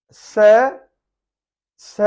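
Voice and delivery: स for "sir", open vowel without R; silent R. Shown as a demonstration. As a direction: say स, leaving off the R. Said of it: "सर नहीं बोलेंगे". (स, आर के बिना)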